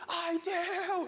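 high, eager mock cry. Man in the front answering the walker, crying out. Man cries back to showman.

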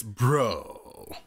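BRO stretched out slowly; slow, lingering BRO; drawn-out BRO. Shown as a demonstration. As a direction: hold the vowel of BRO long. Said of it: "you were lagging out the".

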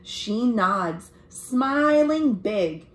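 put-on lively read-aloud for children; story narration. A narrator's reading. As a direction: tell it brightly to young listeners. Reads picture book aloud.

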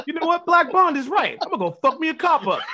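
high-pitched fired-up mock declaration; mock inspired resolve. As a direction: pitch it up like someone suddenly inspired. Mocking an inspired viewer's reaction.

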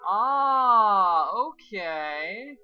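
loud, high startled ah; surprised. Real, not acted. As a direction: react to a shocking reveal. Player surprised by reveal.

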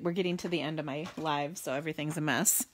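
ordinary talk

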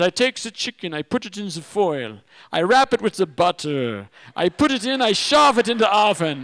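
put-on German accent, reciting each step with scorn; German chef accent. Chef describing how he cooks the chicken, German accent. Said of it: "the big German chef".

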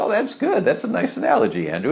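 "Oh" jumps high, then quick praise; mock delighted compliment; pleased surprise. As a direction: retell your delighted reaction to a nice line. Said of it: "And I said".

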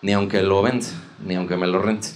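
hushed low voice with dragged final words; politician impression. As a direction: imitate a leader declaring, quiet and low. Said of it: "Entonces dijo".

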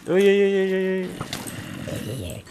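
high, loud run of uys; startled cries of uy; real alarm. Real, not acted. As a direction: yelp in panic. Another danger.

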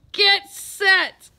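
very loud, high starting call; bellowing. A narrator's reading. Coach starting the swimmers, bellowing. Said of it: "bellowed the coach".

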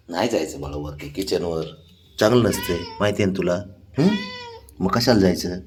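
tender explaining. Real, not acted. Man gently telling the pet not to climb the kitchen, soft tender. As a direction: soothe the pet while you explain.

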